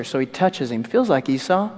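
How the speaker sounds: ordinary delivery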